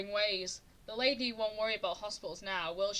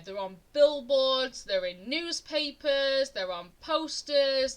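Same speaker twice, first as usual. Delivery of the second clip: BILLBOARDS, NEWSPAPERS, POSTERS each drawn out and loud; slow, loud, punched list stretching billboards, newspapers, posters; emphatic list; 4 semitones higher, 6 dB louder, slower than usual. Real, not acted. Telling viewers the letters were everywhere, stretching billboards, newspapers, posters emphatically.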